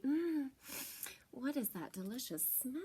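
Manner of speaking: soft, curious skunk; Miss Skunk voice. Acted. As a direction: sniff and wonder aloud, softly. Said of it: "she asked".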